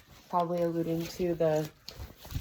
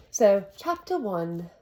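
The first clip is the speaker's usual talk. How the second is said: put-on slower, amateur British accent; 4 dB louder than usual. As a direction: read the chapter title in a British accent. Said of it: "in my British accent".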